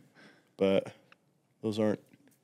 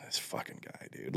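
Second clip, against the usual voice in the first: whispering; 20 dB quieter than usual. Retold or imitated. Annoyed aside under breath.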